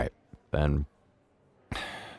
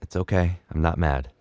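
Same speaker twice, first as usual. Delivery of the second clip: gentle father; 7 semitones lower, 5 dB quieter than usual. Acted. Father gently questions daughter's friends.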